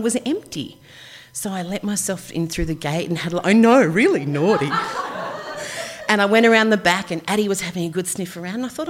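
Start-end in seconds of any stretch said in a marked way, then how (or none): 4.8-6.0 laugh. Real, not acted. Laughing at her own naughtiness.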